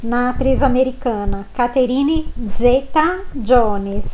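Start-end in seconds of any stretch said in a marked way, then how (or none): 1.6-4.1 Zeta-Jones slowly, with z like dz. Shown as a demonstration. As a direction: draw out Zeta with a dz sound. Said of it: "como se tivesse um D antes".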